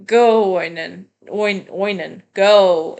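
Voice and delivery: loud, drawn-out go; mock command. Quoting the game line to Oinen, mock loud. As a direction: bark the go at him. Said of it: "there's a line that's like".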